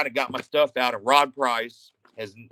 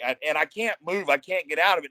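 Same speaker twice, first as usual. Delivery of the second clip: ordinary delivery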